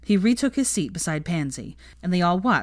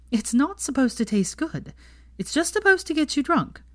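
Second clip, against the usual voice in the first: know-it-all; 10 semitones higher than usual. A narrator's reading. Hermione explaining.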